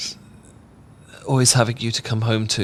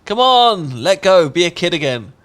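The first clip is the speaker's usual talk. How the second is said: higher, louder, teasing urge; playful call to join in; playful coaxing; 8 semitones higher, 7 dB louder than usual. Acted. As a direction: coax them to let go and play. Urging partner to play.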